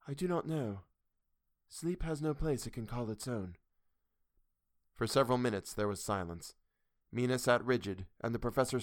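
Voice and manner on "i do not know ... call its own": distant, higher, flat-toned trance; dreamy detached voice; neutral. A narrator's reading. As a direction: speak from far away. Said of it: "The answer came in a neutral way".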